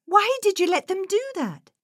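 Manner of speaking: high-pitched startled protest; surprised boy. Acted. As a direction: question the captain in high, shocked disbelief. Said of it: "spluttered Nate in surprise".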